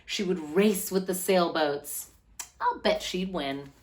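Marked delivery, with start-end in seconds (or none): none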